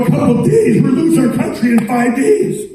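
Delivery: a mock-shocked, high retort at the officials; incredulous outburst. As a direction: pitch jumps way up in disbelief. Retorting to officials' slow reply.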